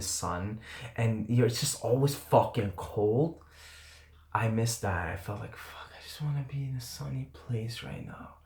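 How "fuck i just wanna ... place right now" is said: breathy whisper, longing; whispered wish. Retold or imitated. Cold and gloomy on the trip, whispered longing for sun.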